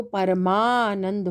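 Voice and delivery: परमानंद with its joined vowel drawn right out; long आ. Shown as a demonstration. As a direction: stretch the "maa" in परमानंद. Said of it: "आ है".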